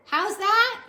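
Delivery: high, loud shouted question; shouting. A narrator's reading. Mig shouting back at Louise, high and loud. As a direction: yell back, not hearing. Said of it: "shouted Meg".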